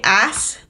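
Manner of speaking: "ass" with a short flat A; British "ass". Shown as a demonstration. Brit shows American co-host how "ass" sounds, short vowel. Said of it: "we don't say it like that".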